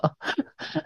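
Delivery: brief, breath-only laugh; real quiet little laugh; soft chuckle. Real, not acted. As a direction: let out a quiet, amused chuckle. Amused by irritated with Wi-Fi.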